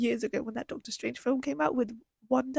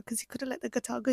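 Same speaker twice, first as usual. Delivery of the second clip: ordinary talk, nothing marked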